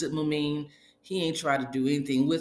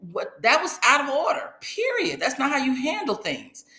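shrill overlapping reality fight; 8 semitones higher, 3 dB louder than usual. Retold or imitated. Quoted fight on reality show.

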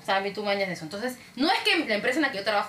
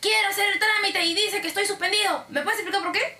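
imitating an indignant caller, pitched up; raised-pitch mock indignation; annoyed customer; 8 semitones higher than usual. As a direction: demand an explanation like an irritated client.

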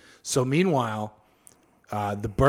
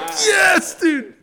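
high, loud whooping cheer with laughter; excited cheer; 7 dB louder than usual. Retold or imitated. Soldiers joining the emperor again, cheering loudly. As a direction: cheer it like a rallying crowd.

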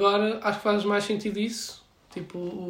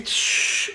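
sizzle noise; 4 dB louder than usual. Retold or imitated. Mimics steak hitting the pan.

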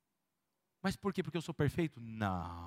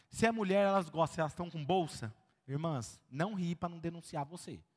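ordinary delivery

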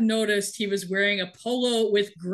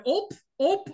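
higher-pitched mock Midwestern "ope"; regional comedian; 9 semitones higher than usual. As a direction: imitate a Midwest comedian's startled little catchphrase. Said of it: "a guy who goes around going".

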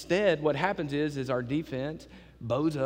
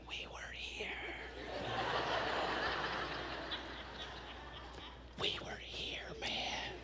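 1 whisper; 12 semitones higher, 15 dB quieter, slower than usual. Retold or imitated. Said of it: "and we were like".

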